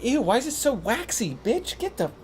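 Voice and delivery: high, squeamish mock disgust; grossed-out friend. Friend handed a waxy earbud, acted as high-pitched disgust.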